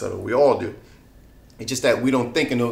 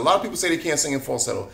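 ordinary talk

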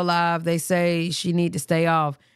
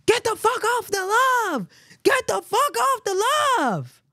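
retold scream; 12 semitones higher than usual. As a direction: imitate him screaming at her to get off the live.

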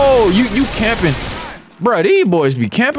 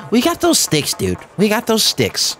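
mocking other players. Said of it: "Y'all be like".

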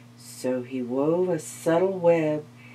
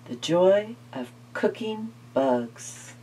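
plain reading voice

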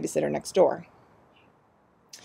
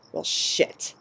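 muttered curse. Acted. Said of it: "curt with anger and alcohol".

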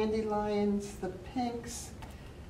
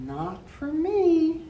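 bunny voice; 9 semitones higher, 6 dB louder, slower than usual. Acted. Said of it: "said the bunny".

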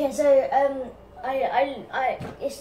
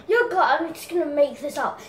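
higher, louder, fast hyped YouTuber intro; Mini Minter impression; 3 semitones higher, 5 dB louder than usual. Making up the YouTuber's intro, hyped and fast. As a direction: hype up the viewers at speed. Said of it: "I'm gonna do an impression of him".

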